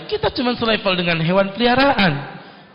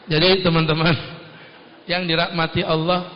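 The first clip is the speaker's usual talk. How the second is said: usual speaking voice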